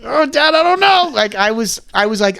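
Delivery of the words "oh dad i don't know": whiny plea. Retold or imitated. Pleading to dad in retold scene.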